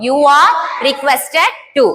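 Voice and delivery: each word drawn out very slowly for the class; slow dictation. Shown as a demonstration. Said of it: "என்ன use பண்ணிட்டோம்". (மிக மெதுவாக dictation சொல்வது)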